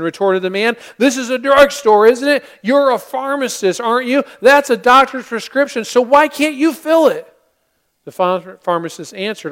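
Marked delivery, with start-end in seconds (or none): 1.0-7.3 louder, higher indignant protest; indignant customer. Acted. Man demanding the pharmacist fill his prescription, indignant and loud.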